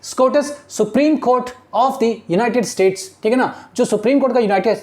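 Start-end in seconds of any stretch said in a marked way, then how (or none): 0.0-3.0 very slow, word by word reading; slow classroom dictation of English text. Shown as a demonstration. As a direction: read it out slowly so students follow. Teacher spelling out acronym slowly.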